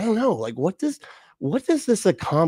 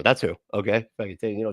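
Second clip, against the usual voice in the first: usual speaking voice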